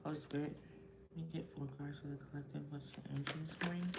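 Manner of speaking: hushed, fast whispered prayer; quiet prayer. Acted. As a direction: pray softly to the spirit guides. Asks spirit for cards.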